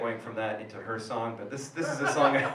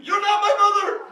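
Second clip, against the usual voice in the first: much higher, louder shout; priest shouting; 9 dB louder than usual. Acted. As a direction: yell it at the demon.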